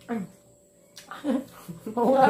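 normal talking voice

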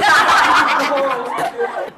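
high burst of laughter; real high laugh. Real, not acted. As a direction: crack up, high. Laughing at madam's retort. (ऊँची हँसी)

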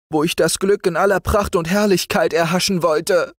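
hohe, jammernde Stimme des Verurteilten; klagender junger Seemann. Acted. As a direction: verzweifelt klagen. (der Verurteilte jammert vor dem Kapitän, hohe klagende Stimme)

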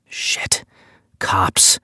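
breathy whisper; whispered thought. Acted. Captive realising they are police, whispered dismay.

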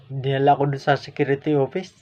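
ordinary talk

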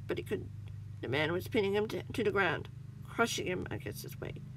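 ordinary delivery

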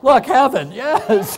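high, loud hammed-up elation; imitating an overjoyed arrival; mock triumphant cheer. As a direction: cheer like you finally reached paradise. Grandpa triumphantly reaches heaven past pad.

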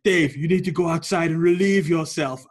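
loud mock-posh English order; prim posh English command, pushed loud. Teacher orders laughing pupil out, mock posh English. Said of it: "very, very posh".

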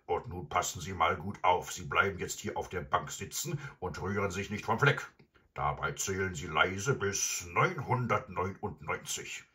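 gruff villain laying down orders; commanding robber. Acted. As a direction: spell out the orders as a stern robber. Robber figure instructing grandmother.

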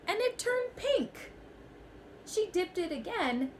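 put-on a child's gasp of discovery; amazed. A narrator's reading. Goat watching its wool dyed, amazed and high. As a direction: pitch leaps high in wonder. Wool surprisingly turns pink.

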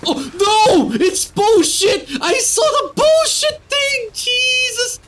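very high, drawn-out panicked wail; real high-pitched gamer panic; panicked outburst. Real, not acted. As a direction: cry out in alarm as it comes at you. Spots a threat in game.

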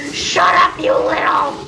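half-hearted yell at a noisy sibling; mock yell. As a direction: pretend to snap at the sister. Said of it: "I'm so tempted to yell at them".

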